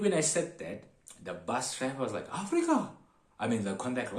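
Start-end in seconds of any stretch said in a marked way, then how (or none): 2.3-2.9 high-pitched mock astonishment; amazed exclamation. Startled conductor reacts to passenger's origin.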